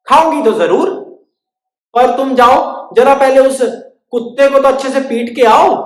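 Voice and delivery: high, loud sing-song; welcoming host. Acted. Stick welcomes, bird demands.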